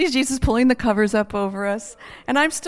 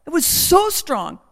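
ordinary talk

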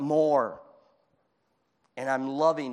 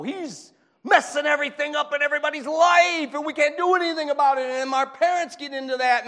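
high, loud, agitated complaint; ranting downtown guy; 10 semitones higher, 5 dB louder than usual. Acted. Man downtown blaming Satan to the preacher, high-pitched rant. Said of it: "So the guy downtown that's saying to me".

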